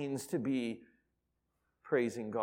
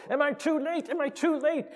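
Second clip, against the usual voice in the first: frantic; 14 semitones higher than usual. Retold or imitated. Frantic customer rushing into store.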